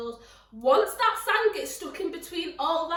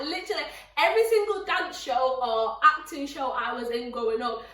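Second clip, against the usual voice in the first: ordinary talk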